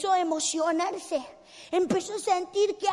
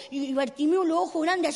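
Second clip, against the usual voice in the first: ordinary talk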